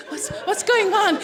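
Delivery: high, stammered mock confusion; bewildered father. Bewildered Manuel, mimicked.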